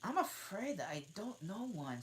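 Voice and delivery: put-on a startled little girl, high and nervous; Alice caught off guard; alarmed. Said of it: "rather alarmed".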